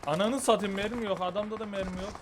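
high, loud frustrated swearing; angry cursing. Real, not acted. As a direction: curse at empty guns. Out of ammo in a fight.

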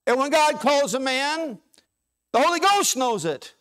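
high, loud preaching refrain; preacher driving home that the devil knows it; full-force preaching. Acted. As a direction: build it like a revival sermon, every line a hammer.

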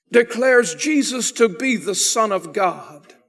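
ordinary delivery

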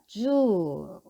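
주 with a long "oo", like zoo; long-vowel reading. Shown as a demonstration. Teacher blends the sounds for learners, 주 held long. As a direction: read 주 in one go, vowel drawn out. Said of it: "이렇게 읽습니다".

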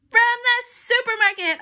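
high, loud blurt; excited shout. A narrator's reading. Boy racing to answer a quiz, excited shout. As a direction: yell your answer eagerly. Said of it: "I shouted".

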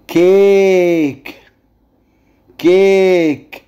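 "كيك" with the vowel stretched right out; long vowel. Shown as a demonstration. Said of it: "انا بمد في الايه". (مد حرف الـ a في "كيك")

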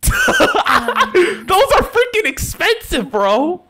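high squeaky laugh through the words, slowly; laughing at Lamborghini idea, then the words squeak out laughing. Real, not acted. Laughing at car price suggestion.

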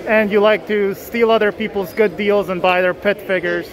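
ordinary delivery

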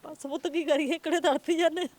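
real grief breaking through as she speaks; tearful. Real, not acted. Grieving mother tells the reporter about her son, tearful. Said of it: "ਬਈ ਤੁਸੀਂ ਕਿਉਂ ਅੱਖਾਂ ਭਰ ਰਹੇ ਹੋ".

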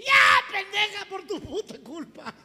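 high, loud burst, then drawn out; hammed-up furious husband; angry blaming. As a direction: lash out at the wife, squealing. Husband blames his wife.

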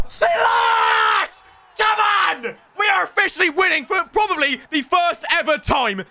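loud, high triumphant shouting; real euphoric shouting; goal celebration. Real, not acted. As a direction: roar with joy at the first win.

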